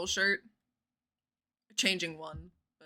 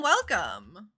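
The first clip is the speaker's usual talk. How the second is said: ordinary talk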